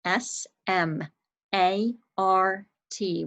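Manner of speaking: letters of "smart" spaced out slowly; slow spelling. Shown as a demonstration. Teacher slowly spells the word for young students. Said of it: "Let's spell it".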